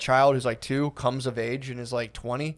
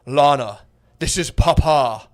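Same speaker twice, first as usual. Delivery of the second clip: louder, slow papa voice; mock solemn father; 7 dB louder, slower than usual. Father introducing himself in audio letter to daughter, mock solemn father. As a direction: announce yourself as a grand patriarch, hammed-up. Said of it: "so her dad started".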